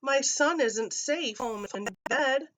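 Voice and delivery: a touch of dread, high; mild moaning mother. Acted. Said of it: "Mother Totters then moaned in dread".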